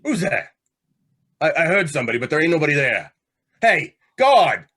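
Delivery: spooked, calling out for the guard; frightened call to the guard; startled fear. Acted. Prisoner hears unseen voice.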